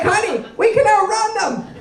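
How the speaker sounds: high, loud eager wife urging a getaway; reckless wife. Retold or imitated. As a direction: egg him on to flee, a touch manic. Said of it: "She's like".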